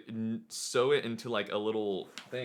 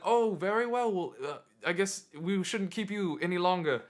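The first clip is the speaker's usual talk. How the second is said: regal court voice; 7 semitones higher than usual. Retold or imitated. King's courtiers grant treasure.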